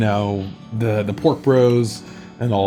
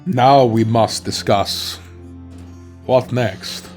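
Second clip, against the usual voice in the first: solemn character voice; slower than usual. Acted. NPC speaks gravely.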